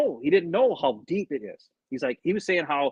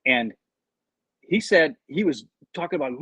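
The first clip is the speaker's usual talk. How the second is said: usual speaking voice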